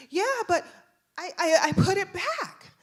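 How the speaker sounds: put-on high, loud kid stammering in protest; protesting child. Son insists to the officer, high loud protest. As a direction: insist like a flustered little kid.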